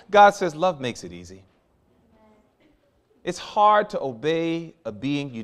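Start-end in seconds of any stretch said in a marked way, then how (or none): 0.6-1.4 tender answer. Acted. Preacher voicing God's answer to the congregation, low and tender.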